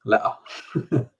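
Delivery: le'a through a breathy laugh; laughing imitation. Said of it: "he says le'a".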